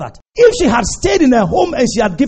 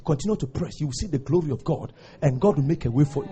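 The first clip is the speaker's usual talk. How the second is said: ordinary talk, nothing marked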